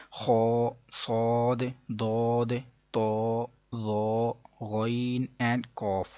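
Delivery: "خو، صاد، داد" heavy and rounded toward o, held long; full-mouthed heavy letters. Shown as a demonstration. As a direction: make "تو، ظا، غین، قاف" thick and full, slowly. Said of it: "ذرا بھاری آواز میں موٹا". ("خو، صاد، داد، تو، ظا" موٹے، پُر، بھاری آواز میں)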